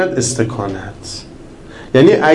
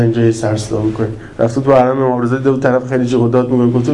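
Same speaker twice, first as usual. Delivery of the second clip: ordinary talk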